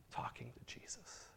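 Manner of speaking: hushed whisper. Retold or imitated. Old man confiding to the pastor, whispered. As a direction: let it drop to a secret. Confiding secret prayer habit.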